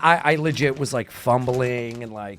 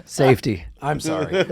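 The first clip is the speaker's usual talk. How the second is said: usual speaking voice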